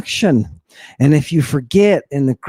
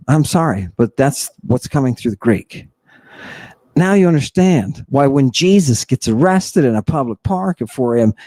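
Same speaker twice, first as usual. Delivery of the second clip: ordinary delivery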